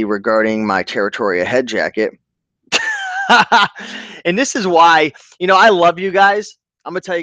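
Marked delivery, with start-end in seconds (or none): 2.7-4.2 short high burst of real laughter. Real, not acted. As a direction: laugh out at the message. Laughs at an eBay message.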